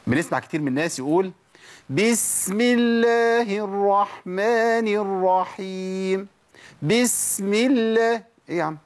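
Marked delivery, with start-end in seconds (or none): 1.9-6.3 high, very slow chanted basmala with "بسم" hissed long; exaggerated, drawn-out chant with a long hiss on "بسم"; overdone seen recitation. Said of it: "الرخاوة بتزيد". 6.8-8.2 high, very slow chant with "بسم" hissed long; exaggerated, stretched "بسم"; overdone seen recitation. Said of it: "الرخاوة بتزيد".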